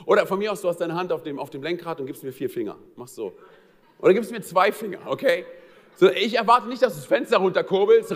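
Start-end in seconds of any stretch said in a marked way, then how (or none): none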